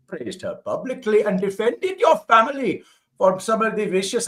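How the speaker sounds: put-on high, loud Indian accent read. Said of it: "Your, your accent is so good".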